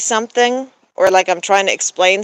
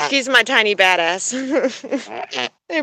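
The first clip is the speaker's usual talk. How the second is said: ordinary talk, nothing marked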